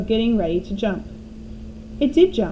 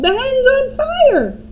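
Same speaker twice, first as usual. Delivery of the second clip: high, loud, slow alarmed yell; grandma yelling about the burning hen; 7 dB louder, slower than usual. A narrator's reading. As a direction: cry out in an old woman's voice. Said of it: "Grandma yelled".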